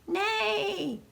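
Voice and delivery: high neigh; whinny it like a horse. Acted. Reading a baby book to children, high horse neigh. Baby says animal sounds.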